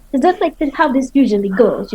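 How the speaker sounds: ordinary talk, nothing marked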